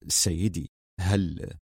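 astonished. A narrator's reading. Office manager questioning his laughing director, astonished. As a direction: falter in muted astonishment. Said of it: "قال مدير مكتبه في دهشة".

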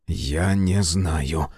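slow, faltering reply; hesitant. Acted. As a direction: stumble over the words. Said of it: "запинаясь".

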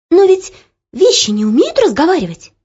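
high, loud amazed protest; astonished. Acted. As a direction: object in wide-eyed disbelief. Startled girl objecting.

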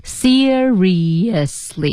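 Seriously stretched into SEER-ree-yus-lee; very slow repeat of Seriously, four clear beats; four syllables. Shown as a demonstration. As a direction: sound every syllable of Seriously. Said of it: "四个音节".

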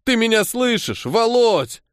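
high, pleading voice; plaintive, distressed appeal; tearful. A narrator's reading. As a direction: call to your hurt friend, close to tears. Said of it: "плачуще говорил Андрюха".

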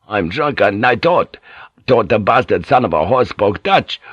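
usual speaking voice

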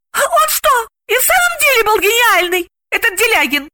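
very high, curious question; intrigued. A narrator's reading. Galina asks about the dead director, high and intrigued. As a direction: ask with keen interest up high. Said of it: "заинтересовалась Галина".

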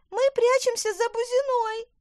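put-on very high, loud girlish squeal; high squeak. A narrator's reading. Girl hiding behind the bush, loud squeak. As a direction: squeak it out shrilly. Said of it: "пискнула Лина".

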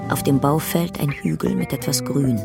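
ordinary talk, nothing marked

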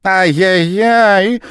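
very high, slow, loud wail of dismay; dismayed exclamation. A narrator's reading. The Japanese man wailing at the gentleman, high and slow. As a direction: tut in mock horror. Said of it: "воскликнул японец".

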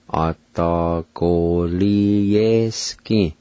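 A da go li ye ski said very slowly, every syllable clear; slow careful pronunciation. Shown as a demonstration. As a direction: drag A da go li ye ski out for the learner. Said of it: "pronounce these words".

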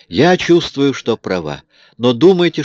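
the heroine insists she is right; woman's voice. A narrator's reading. (упрямая женщина)

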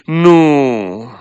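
bitter, loud refusal; bitter cry. Acted. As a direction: spit out the no with bitterness. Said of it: "strigă ea cu un ton amar".

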